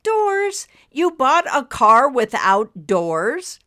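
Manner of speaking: high, loud, slow disbelief; incredulous. A narrator's reading. Wife questions husband about missing doors, incredulous. Wife astonished at doorless car.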